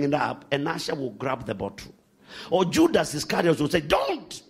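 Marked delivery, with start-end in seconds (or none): none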